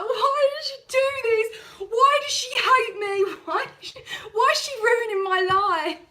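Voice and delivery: high, loud hammed-up despair; mock distraught wail. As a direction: wail like your life is ruined, half laughing. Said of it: "I'd be like".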